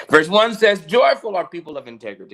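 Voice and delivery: preacher rereading the psalm to close; preaching. Acted. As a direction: proclaim the verses with force.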